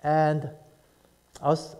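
normal talking voice

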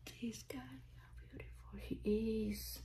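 soft hushed whisper; quiet whispered wonder; awed whisper. Real, not acted. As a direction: whisper your admiration. Hushed admiration of the doll.

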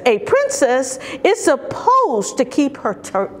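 usual speaking voice

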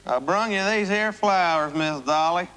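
hokey Southern bumpkin voice, a bit high; cornball country accent. Acted. Suitor presenting flowers in a hammed-up country accent. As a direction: lay the country accent on thick. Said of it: "you're doing this cornball accent for".

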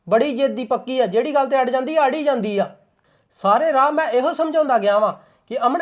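husband arguing with wife about his sister; heated retort. Acted. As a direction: push back at her, irritated.

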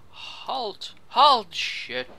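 loud urgent yell; shouting halt. Real, not acted. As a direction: bellow the order to stop. Yelling at troops in game.

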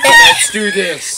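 shouting through laughter; gleeful yell as the bucket tips; laughing shout. Real, not acted. Dumping the ice bucket.

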